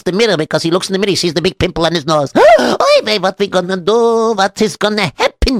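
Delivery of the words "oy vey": gasping high oy vey; panicked gasp. Acted. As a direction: gasp in sudden alarm.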